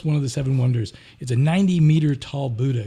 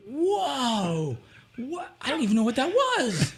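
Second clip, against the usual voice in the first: higher, bewildered voice; baffled; 7 semitones higher, slower than usual. Retold or imitated. Traveler baffled by the stranger's song, high and bewildered. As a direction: sound lost and amazed.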